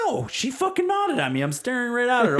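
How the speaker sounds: very high, loud indignant wife; exasperated shout. Retold or imitated. Wife insisting the daughter ignored her, loud and shrill-high. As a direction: yell it up high, fed up. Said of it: "She's like".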